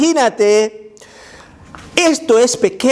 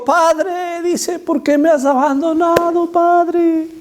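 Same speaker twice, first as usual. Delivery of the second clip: anguished cry; 9 semitones higher, slower than usual. Retold or imitated. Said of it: "y Jesús dijo".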